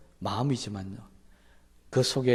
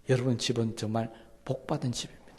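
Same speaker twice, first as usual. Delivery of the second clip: normal talking voice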